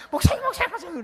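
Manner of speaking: the evangelist rings again, high and breathless with excitement; excited calling. Retold or imitated. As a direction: shout for the pastor, bursting with news.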